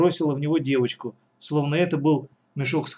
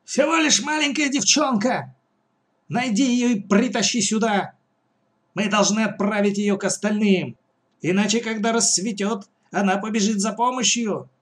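high-pitched mother giving orders; acted high-pitched scolding; mother crying out; 6 semitones higher than usual. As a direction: order them about in a high, raised pitch. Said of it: "закричала мать".

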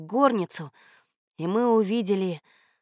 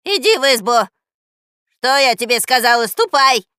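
put-on loud, raised, commanding old woman; stern scolding; 4 semitones higher, 12 dB louder than usual. A narrator's reading. Old woman sending her cat inside, sternly. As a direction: order sharply indoors. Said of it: "строго сказала старушка".

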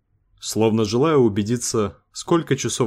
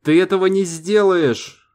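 much higher, loud threatening cry; frantic shout; 9 semitones higher, 4 dB louder than usual. A narrator's reading. Сейга yells at Лукас holding him at gunpoint. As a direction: scream it, losing control. Сейга keeps yelling, gun drawn.

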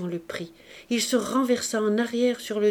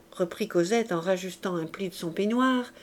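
usual speaking voice

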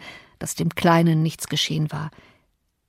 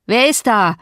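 sharp call; 3 semitones higher, 5 dB louder than usual. Acted. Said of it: "fragte er".

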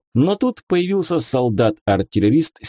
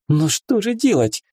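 high, ashamed exclamation; distressed young lady, pitched up; dismayed gasp; 9 semitones higher than usual. A narrator's reading. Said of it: "ахнула барышня со стыдом".

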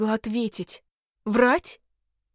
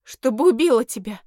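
louder outburst that cracks; voice breaking; 2 semitones higher, 7 dB louder than usual. A narrator's reading. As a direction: blurt it out as the voice breaks. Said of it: "Голос мой сорвался".

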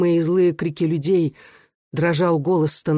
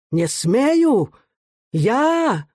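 high, outraged howl; indignant rising wail; drawn-out howl; 8 semitones higher, much slower than usual. A narrator's reading. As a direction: howl it in disbelief, stretching the vowels. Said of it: "протяжно взвыл Становой".

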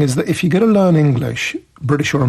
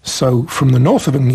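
ordinary delivery

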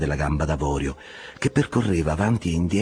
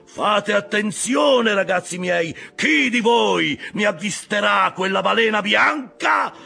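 very high, loud rallying cry; full-voiced shout; 5 dB louder than usual. A narrator's reading. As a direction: proclaim the reward at full voice to the crew. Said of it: "a voce spiegata gridò". (gridato a voce spiegata, acutissimo)

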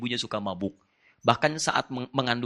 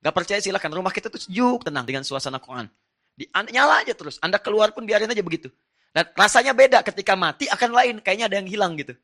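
much higher and much louder, driving preacher's push; fiery preaching; 7 semitones higher, 7 dB louder than usual. Acted. Preacher urging the congregation to keep Quran playing, fiery. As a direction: preach it with rising fervour.

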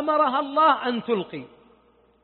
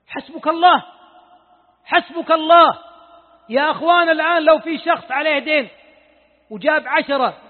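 preaching at full force; 5 semitones higher, 7 dB louder than usual. Acted. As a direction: drive each الله home to the crowd. Preacher raises voice again.

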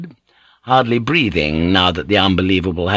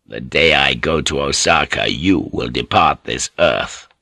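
much lower, deliberate lord's voice; cold threat; 7 semitones lower than usual. A narrator's reading. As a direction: pronounce the threat low and measured. Lord threatens the old councillor.

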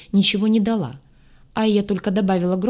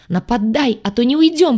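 hoarse; 5 semitones higher, 6 dB louder than usual. Acted. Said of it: "хрипела сорванным голосом я".

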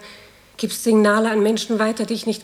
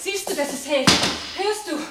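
urgent insistence; 6 semitones higher than usual. Acted. Urgently proving the wall holds.

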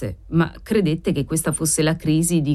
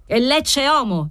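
slow, higher-pitched imperious pronouncement; cold, imperious; 6 semitones higher than usual. A narrator's reading. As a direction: repeat it coldly, allowing no reply. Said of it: "freddamente con quella sua aria imperiosa".

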